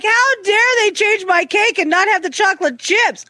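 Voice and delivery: high-pitched mock outrage; hammed-up fury, high voice; furious daughter. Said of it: "And she was furious".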